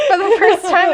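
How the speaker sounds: high, loud, laughing words; real laughing. Real, not acted. Reader teased about avoiding Baroque, laughing admission. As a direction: talk through a burst of laughter. Cracking up after being teased.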